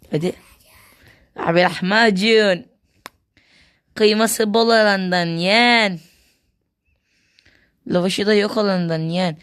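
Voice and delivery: slow sing-song vendor call; melodic street vendor shout. Acted. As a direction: hawk lahmacun, drawn out loud. Vendor calling out.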